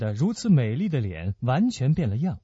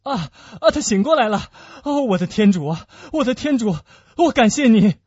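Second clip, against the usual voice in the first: high, loud burst of relief; fervent, excited exclamation; relieved cry; 6 semitones higher, 6 dB louder than usual. Acted. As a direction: cry out thanks as fear lifts. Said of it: "年轻人叫了起来".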